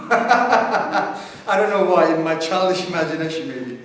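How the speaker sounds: laughing it out, loud and slow; real laugh through the words. Real, not acted. Laughing at own fantasy.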